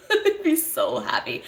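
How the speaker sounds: giggling with joy; laughing happily. Real, not acted. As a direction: say it through a happy laugh.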